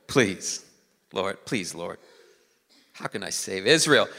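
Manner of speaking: low, doubtful plea; Gideon. Acted. Weak man answering God's call, low and doubting. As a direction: drop low and soft, pleading without belief. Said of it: "I think this is how he sounds".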